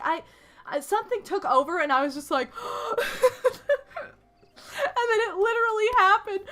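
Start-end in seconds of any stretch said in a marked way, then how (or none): 2.5-6.4 high gasp breaking into laughter; laughing gasp. Real, not acted. Retelling her announcing panic, laughing high gasp. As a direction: gasp then laugh through the words.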